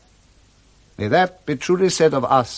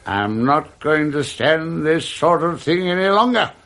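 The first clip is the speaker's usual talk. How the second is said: ordinary delivery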